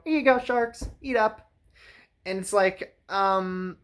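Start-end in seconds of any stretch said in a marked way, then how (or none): 0.0-1.5 mock cheerful kids. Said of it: "and go".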